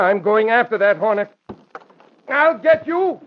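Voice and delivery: loud, high, raging threat; furious shout. Acted. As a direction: bellow it after the fleeing Hornet. Gambler rages at fleeing Hornet.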